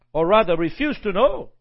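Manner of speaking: ordinary talk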